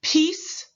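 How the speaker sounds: teacher stretching the final s; final hiss. Shown as a demonstration. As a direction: hiss out the end of "Peace,". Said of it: "Repeat after me".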